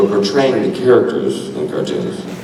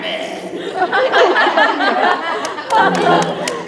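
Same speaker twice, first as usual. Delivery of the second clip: laughing. Real, not acted. Laughs after Batman attempt.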